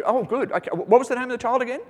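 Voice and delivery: joking skit of a king who can't remember; mock Solomon. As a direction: rush it like a king who forgot. Solomon asking child's name.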